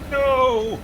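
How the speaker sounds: sudden high cry of dismay. Real, not acted. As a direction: yelp at losing the gun. Chain gun lost in game.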